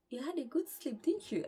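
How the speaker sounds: high, loud, lilting question; teasing seatmate. Retold or imitated. Lady sitting beside the speaker after landing, teasing.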